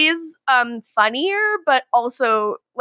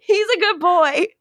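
higher, laughing plea on drawn-out words; laughing protest; 7 semitones higher, slower than usual. Real, not acted. Co-host jokingly defends Enzo from Steve, laughing protest. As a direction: protest through giggles.

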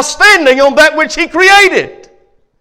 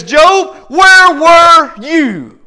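slow, loud, thundering; booming voice of God; 4 semitones higher, 4 dB louder, much slower than usual. Retold or imitated. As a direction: speak as God from on high. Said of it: "this is what he says".

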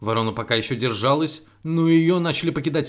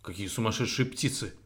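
much softer, low moan; groaning sparrow; 4 semitones lower, 10 dB quieter than usual. A narrator's reading. Pecked sparrow groans after the crow flies off. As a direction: groan it, dazed and hurt. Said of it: "застонал воробей".

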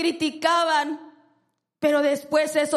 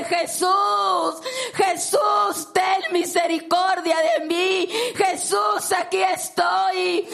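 pleading shouts, higher, building; crying out; 4 semitones higher, slower than usual. Acted. Blind beggar crying out to Jesus over the crowd, pleading shouts. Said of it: "comenzó a gritar más fuerte y más fuerte".

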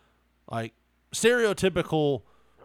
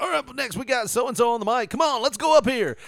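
karaoke host hype; 9 semitones higher, 4 dB louder, faster than usual. Retold or imitated. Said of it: "He's like".

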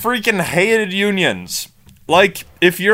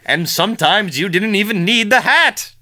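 West Virginia miner voice; 5 semitones higher than usual. Retold or imitated. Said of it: "West Virginia nineteen twenties miner voice".

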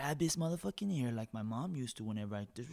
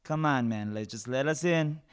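calling out; 4 semitones higher, 9 dB louder than usual. Retold or imitated. Calling through the door.

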